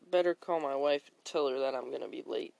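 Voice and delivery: ordinary talk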